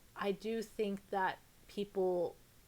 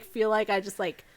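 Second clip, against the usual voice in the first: usual speaking voice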